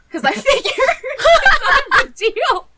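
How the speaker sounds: high, slow laughing words; real laughter breaking through the words. Real, not acted. Laughing while telling the mishap.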